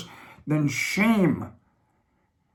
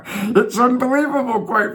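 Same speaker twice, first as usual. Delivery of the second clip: laughing disbelief; 7 semitones higher than usual. Real, not acted. Laughs at neighbours' habits.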